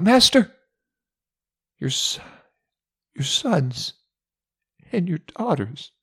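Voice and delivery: a sharp high cry of "Master", then breaking off and faltering; a desperate servant who can hardly get the words out. Acted. The fourth servant brings Job the news of his children, desperately. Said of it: "This cry was a little more desperate".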